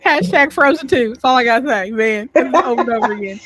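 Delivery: high, loud fangirl squeal; excited gush. Real, not acted. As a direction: gush like a Frozen superfan. Excited fan gushing about Frozen.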